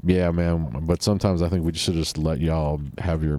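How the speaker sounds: ordinary talk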